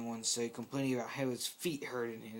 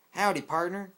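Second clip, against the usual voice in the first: higher, louder Texan howdy; Texan accent; 6 semitones higher, 7 dB louder than usual. Acted. Applejack greeting Engineer in a Texan accent. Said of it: "a pony talk in a Texan accent".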